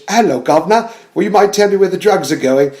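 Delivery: lightly voiced British accent; a hint of mock cockney Batman. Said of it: "he's trying to be British".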